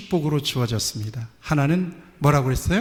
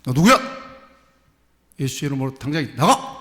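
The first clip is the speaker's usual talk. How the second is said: commanding shout; 4 dB louder than usual. Retold or imitated. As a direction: order the demon out with full force. Pastor commands demon to leave.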